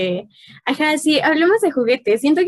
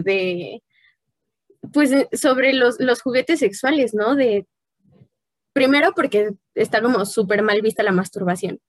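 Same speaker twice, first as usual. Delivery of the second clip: ordinary talk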